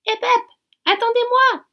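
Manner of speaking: high, loud call from behind; a frog shouting to the departing friends. A narrator's reading. Frog shouting after the travellers to wait. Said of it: "crie une grenouille".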